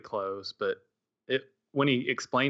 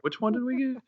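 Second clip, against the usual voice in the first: mock confusion; 12 semitones higher than usual. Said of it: "So it was kinda confusing".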